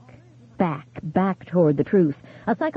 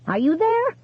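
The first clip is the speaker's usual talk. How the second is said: high, rising call into silence; desperate call; 12 semitones higher than usual. A narrator's reading. Widow calls into the dead phone line, high and desperate. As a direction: call out, afraid he's gone.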